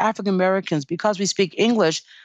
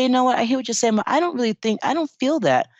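raised, assertive voice standing your ground; firm pushback; 6 semitones higher than usual. Pretend. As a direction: push back politely but firmly. Mother pushing back on doctors.

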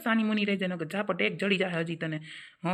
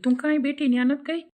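accusing; 6 semitones higher, 5 dB louder than usual. Acted. Accusing question.

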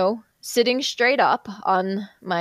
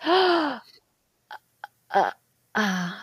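searching hard; 2 semitones higher, slower than usual. Retold or imitated. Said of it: "you could see he's searching hard".

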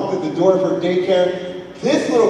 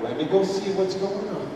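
calm, soothing; 6 dB quieter than usual. Retold or imitated. Wife calming him.

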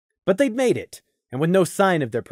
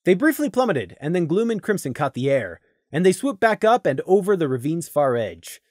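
plain reading voice

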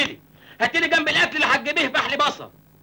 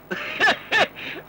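mocking laugh; 4 semitones higher than usual. Mocks the word بلوزي.

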